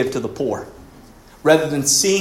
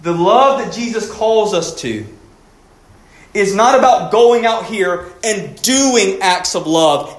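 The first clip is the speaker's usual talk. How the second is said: raised, emphatic sermon delivery; preaching at full force; 8 semitones higher, 4 dB louder, slower than usual. Acted. Preacher urging the church to be loving, emphatic. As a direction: plead with them to change, slowly. Preaches being over doing.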